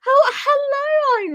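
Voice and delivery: high, slow, delighted hello; excited greeting. Real, not acted. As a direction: squeal a happy hello.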